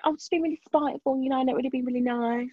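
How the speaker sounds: mimicking the teacher. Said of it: "It's like".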